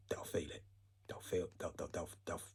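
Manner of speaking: "They'll feel it" breathed in a soft, voiceless whisper; ominous whisper. Acted. Speaker warning of the aftermath, whispered. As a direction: drop to a menacing whisper on "feel". Quiet threat repeated as whisper.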